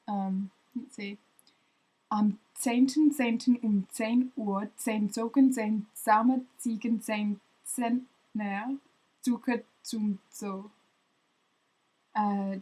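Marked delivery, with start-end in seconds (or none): 2.1-10.7 slow, careful German tongue twister; slow first try. Shown as a demonstration. First go at the Z tongue twister, slow and careful. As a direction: lean on every Z sound slowly. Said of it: "Try that one faster".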